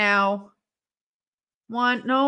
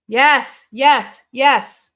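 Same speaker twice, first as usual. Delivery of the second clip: excited cheering; 3 semitones higher, 9 dB louder, much slower than usual. Real, not acted. Cheering a reversal.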